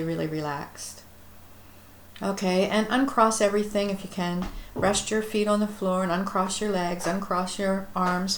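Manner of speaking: normal talking voice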